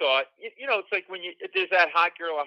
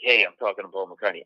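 smooth and cool, pitched low; low, easy, unfazed cool; really smooth; 8 semitones lower, 4 dB louder than usual. Retold or imitated. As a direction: play it casual, as if it's no big deal. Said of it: "I'm really smooth. I'm like".

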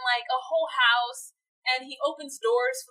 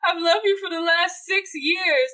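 mock tearful plea; 6 semitones higher, 4 dB louder than usual. Acting weeping confession.

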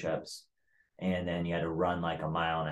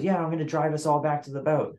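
high, louder put-on voice; mock chummy chief; 9 semitones higher, 5 dB louder than usual. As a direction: imitate an over-friendly boss offering a favour. Chief's retold offer of a ride.

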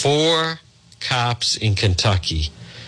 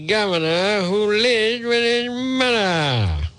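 high, slow, sneering singsong; mocking singsong; 12 semitones higher, slower than usual. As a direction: draw it out to ridicule the governor. Running mocking gag on governor.

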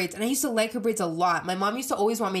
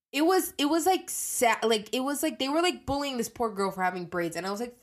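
normal talking voice

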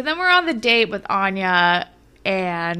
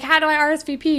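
high, bright eager question; eager Fiona; 8 semitones higher, much faster than usual. Retold or imitated. Fiona asking Adam how to RSVP, high. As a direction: lightly mimic eager asking. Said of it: "she's like".

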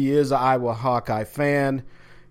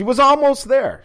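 high squeak; 11 semitones higher, 5 dB louder than usual. Acted. Teasing reaction to accent attempt.

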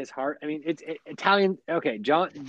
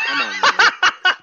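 high, loud, laughing protest; exasperated outburst; 9 dB louder, much slower than usual. Real, not acted. Player complains to teammate about questions, laughing exasperation. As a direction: protest loudly through a laugh.

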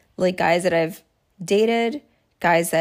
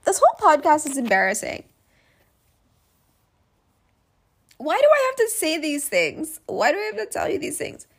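exasperated whine; 8 semitones higher than usual. Real, not acted. To podcast listeners, embarrassed at oversharing, high exasperated whine. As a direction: wail it like you can't believe yourself. Mock-despairing outburst on podcast.

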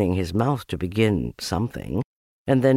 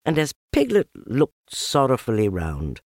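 plain reading voice

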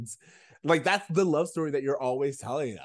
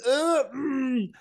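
a high, drawn-out ugh of distaste; mock disgusted groan; 13 semitones higher, 3 dB louder than usual. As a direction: groan as if something rubbed you wrong. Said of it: "you were like".

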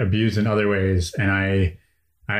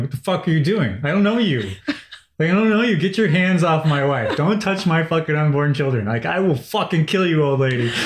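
mock angry yell; 6 semitones higher than usual. Husband retelling telling off an old lady touching his wife, mock furious. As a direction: tear into the stranger, half playing it up. Confronting stranger touching wife's belly.

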